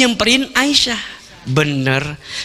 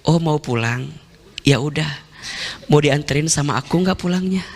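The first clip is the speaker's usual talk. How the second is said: soft, easygoing offer; calm him down, lightly and softly; a softer, kind husband's voice; gentle husband; 6 semitones lower, 4 dB quieter than usual. Acted. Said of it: "Apa kata Nabi?".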